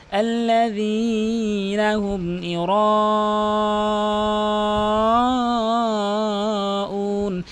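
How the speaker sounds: very slow chant, huge hold on "ইউরাউন"; four-alif elongation. Shown as a demonstration. Teacher demonstrates four alifs, extremely drawn-out chant. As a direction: sustain the "রা" of "ইউরাউন" in one long breath. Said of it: "র অবশ্যই চার আলিফ টেনে পড়তে হবে".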